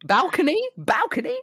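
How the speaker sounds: high-pitched rising questions, reenacted; mock excited wondering. Streamer relives choosing pricier seats, mock excited wondering. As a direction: squeak each option as a tempted question.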